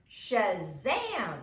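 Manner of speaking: loud burst of a yell, arms flung out. Acted. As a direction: shout it out like a superhero's magic word. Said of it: "Gladys yelled".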